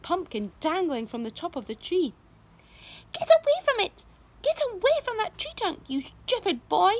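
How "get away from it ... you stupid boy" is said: very high, loud furious shriek; angry yell. A narrator's reading. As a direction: scream at the boy to get back. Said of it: "Aunt Spiker yelled".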